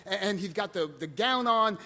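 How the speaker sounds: ordinary delivery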